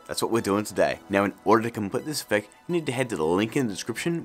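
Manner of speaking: ordinary talk, nothing marked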